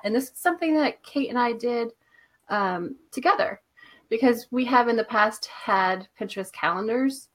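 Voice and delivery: ordinary talk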